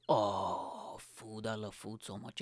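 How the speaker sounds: dreamy about food. Real, not acted. Confessing his love of food to listeners, soft and dreamy. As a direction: sigh it like a daydream.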